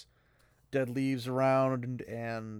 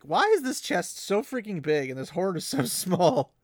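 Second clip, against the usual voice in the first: higher and louder, mock disbelief; incredulous; 7 semitones higher, 7 dB louder than usual. Reader marvels at the tiny hoard, incredulous. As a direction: pitch it up in amused disbelief. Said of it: "I'm thinking".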